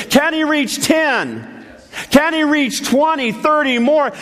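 high, ringing preacher voice; fiery preaching climax; full-force preaching. Real, not acted. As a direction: preach it up to the congregation.

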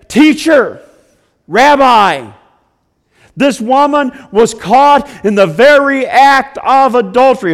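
loud, high, accusing cry; the crowd accusing the woman, loud; accusers' voice. Acted. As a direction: call out to the teacher, accusing.

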